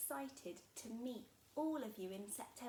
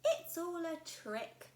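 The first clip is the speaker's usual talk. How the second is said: louder, slow suspicious little monster; knowing Gruffalo child; 6 semitones higher, 7 dB louder, slower than usual. Acted. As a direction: she has caught them out. Child sees through it.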